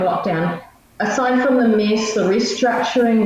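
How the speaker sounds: normal talking voice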